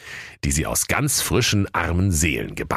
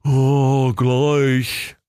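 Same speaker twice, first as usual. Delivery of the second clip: weary villager; 7 semitones higher, 4 dB louder than usual. A narrator's reading. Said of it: "sagte einer der Dorfbewohner matt".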